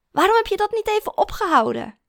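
loud, high accusation; exasperated reproach. A narrator's reading. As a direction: demand an explanation, appalled. Disgusted boyfriend reproaches her.

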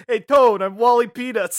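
loud, laughing mobster impression; Paulie Walnuts. Paulie Walnuts greeting his boss in costume, mock mobster. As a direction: call it out as a goofy gangster. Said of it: "said Paulie Walnuts".

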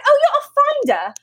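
high-pitched imitation of a British accent. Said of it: "I always do her accent".

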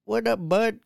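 high mock Canadian greeting; Canadian accent. Friend showing what Canadians say, mock Canadian accent. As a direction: hail a buddy in high, exaggerated Canadian. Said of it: "They say".

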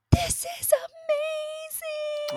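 very high, drawn-out delight; imitated elated child. Mom imitating daughter riding, blissful high-pitched wonder. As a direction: stretch each word out, high and dreamy. And I hear her go.

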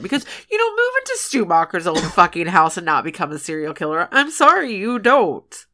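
ordinary delivery

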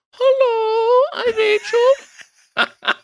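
very high, loud falsetto, slow and laughing; a man squeaking out a fake woman's voice; mock falsetto Rachel. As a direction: call agent pretending to be Rachel, falsetto. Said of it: "comes back and goes".

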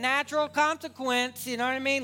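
loud, high impassioned sermon; preaching at full force. Acted. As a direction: preach it to the back row. Preaching dad's viewpoint.

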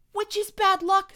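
very high, loud yelp; alarmed cry. A narrator's reading. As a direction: blurt it out in alarm. Panicked quarrel over the omen.

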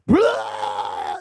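loud, drawn-out mock puke roar; imitated retching. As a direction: fake a big throaty vomit noise. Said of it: "starts puking out, like, Martian snot".

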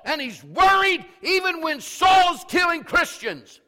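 loud, high, impassioned sermon shout; fired-up preacher at full volume; full-force preaching. Real, not acted. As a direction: drive the point home to the congregation.

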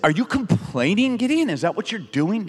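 high-pitched incredulous questioning; mock indignation. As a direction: play-act being offended by a complaint. Imagined indignant God questioning Gideon.

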